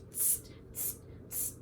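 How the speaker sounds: short voiceless "tss" noises; monkey chatter. A narrator's reading. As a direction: imitate mocking monkeys with three soft hisses. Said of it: "at him and said".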